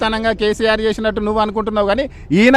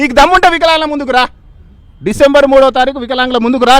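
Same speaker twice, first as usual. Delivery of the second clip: loud, raised-pitch dare; heated challenge; 3 semitones higher, 6 dB louder than usual. Real, not acted. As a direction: call him out with full force. Daring the Chief Minister to appear.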